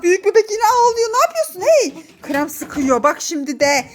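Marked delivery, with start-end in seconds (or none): 0.0-2.0 high, urgent telling-off; alarmed scolding. Real, not acted. Mother catches older child misbehaving, alarmed scolding.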